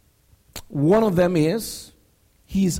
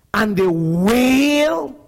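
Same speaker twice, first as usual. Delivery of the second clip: "wail" drawn out slow and louder, a long falling wail; stress on wail; 2 semitones higher, 4 dB louder, slower than usual. Shown as a demonstration. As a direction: lean hard into "wail", stretching it. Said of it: "I want to emphasize that word wail".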